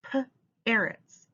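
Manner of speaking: "P-arrots" with the p sound pulled apart from "arrots"; onset split. Shown as a demonstration. Teacher blending "P-arrots" for children. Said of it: "What animal am I saying?".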